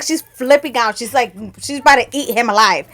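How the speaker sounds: ordinary talk, nothing marked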